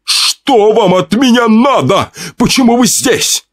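very high, slow shout at the killer; husband shouting at the killer with a chair; desperate shout. Acted. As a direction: yell at him in terror. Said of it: "встал наперерез убийце и закричал".

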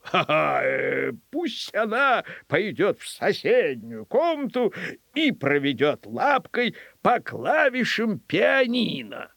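laugh, hesitate, then lilt out the task; amused governor. Acted. Governor sets the dog another test, amused musing.

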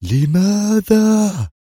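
long, drawn-out high cry of rage; furious scream. A narrator's reading. Commander screams at losing his quarry, furious drawn-out shout. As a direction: stretch the word out in a high, angry yell. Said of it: "وبكل غضب الدنيا صرخ".

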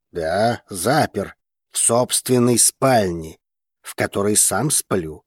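usual speaking voice

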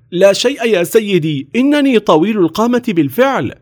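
put-on high-pitched flustered boy. A narrator's reading. Napoleon covering up to the officer, flustered. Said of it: "وهتف نابليون بارتباك".

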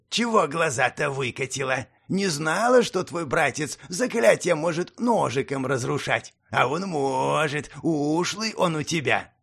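slow, mocking goblin voice; goblin sneer. Goblin taunts Slavka.

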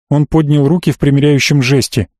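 ordinary delivery, nothing marked

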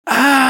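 loud high pained scream. A narrator's reading. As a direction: cry out in agony. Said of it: "Впервые закричала Гвил".

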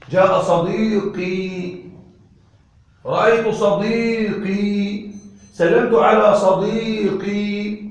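صديقي said very slowly and clearly, with no vowel on the qaf; slow example sentences. Shown as a demonstration. Teacher drills students on صديقي, slow and deliberate. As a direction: spell out صديقي slowly with its kasra before the ya, each time. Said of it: "هل أنا نطقت ضمة؟".

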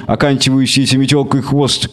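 usual speaking voice

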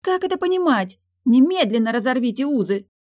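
put-on high, loud girlish tantrum; spoiled noblewoman's furious outburst; outraged demand. A narrator's reading. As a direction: demand it at the top of your pitch. Outraged marquise demands the bond be broken.

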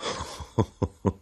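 soft string of chuckles; a mild narrated chuckle; light acted laugh. A narrator's reading. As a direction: laugh lightly, mostly on breath.

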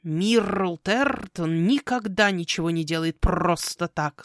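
slow croak with rolled r's; ominous caw. Acted. Raven warning the girl about Tertон, ominous caw. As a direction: warn darkly, rolling each r. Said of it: "со зловещей интонацией каркнул Ротгар".

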